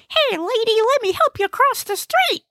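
very high, eager comic voice; high-pitched eager little helper; eager Gnesi. Acted. Gnesi wants to help an old lady cross, high and eager.

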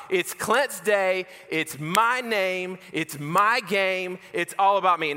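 mock arrogant declaration, each phrase stretched; self-centred boasting. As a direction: proclaim it like a chant to yourself. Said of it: "I was all about".

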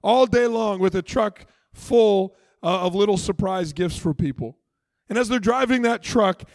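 normal talking voice

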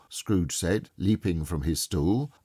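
usual speaking voice

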